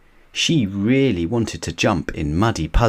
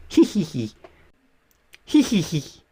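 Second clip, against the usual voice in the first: put-on giggling kids; 11 semitones higher than usual. Children giggling early morning.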